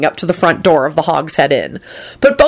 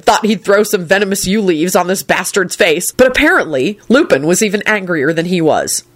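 ordinary delivery, nothing marked

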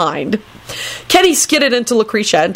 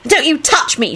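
threatening yell; 9 semitones higher than usual. A narrator's reading. Rosie fending off Kenny, mock-yelling a threat. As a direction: warn him off, pitched up. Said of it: "she yelled threateningly".

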